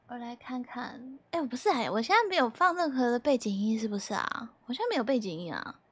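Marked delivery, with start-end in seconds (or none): 0.1-1.2 whispered aside to herself; unvoiced little murmur while checking. Real, not acted. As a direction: breathe it out, no voice. Checking stream settings aside.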